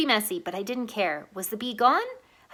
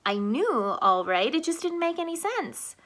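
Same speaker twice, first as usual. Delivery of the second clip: ordinary delivery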